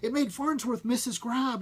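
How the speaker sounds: plain reading voice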